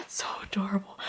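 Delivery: soft unvoiced whisper. Real, not acted. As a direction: whisper it close to the mic. Whispering while showing calendar.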